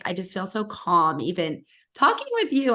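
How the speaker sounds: usual speaking voice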